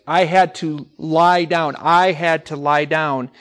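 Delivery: "I had to lie down" drawn out slowly; slow model sentence. Shown as a demonstration. Teacher models the correct verb slowly for the class. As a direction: take it slow, word by word. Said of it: "When something is receiving it".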